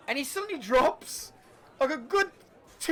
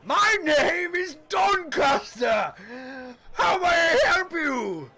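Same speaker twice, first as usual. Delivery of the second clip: slow, loud, higher innkeeper greeting; booming barkeep; 7 semitones higher, 5 dB louder, slower than usual. Acted. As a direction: announce yourself grandly. Barkeep introduces himself.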